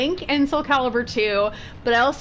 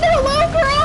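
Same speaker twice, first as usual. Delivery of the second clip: much higher, loud gushing hello; excited greeting; 6 dB louder, slower than usual. Real, not acted. Greeting the game dragon, gushing loud and high. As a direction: coo at it like a cute pet.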